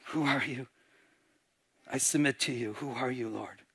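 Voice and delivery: low, hushed humble surrender; awed submission. Retold or imitated. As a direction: submit softly, voice sinking away. Saul's humbled submission to God.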